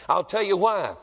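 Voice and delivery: ordinary talk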